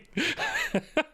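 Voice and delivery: high squeaky laugh. Real, not acted. Laughing at chat message.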